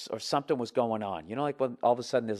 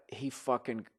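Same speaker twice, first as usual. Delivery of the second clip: ordinary talk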